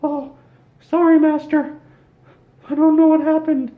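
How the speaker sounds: very high, loud squeaky apologetic mouse; a groveling falsetto servant begging his master; Mickey Mouse voice. Acted. Mickey apologizes to his master, high and squeaky. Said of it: "Mickey turned around and said".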